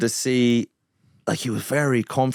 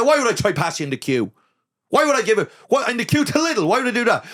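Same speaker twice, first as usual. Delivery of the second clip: outraged, hyped up; mock indignant queue rant; incredulous retort; 12 semitones higher than usual. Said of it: "I said".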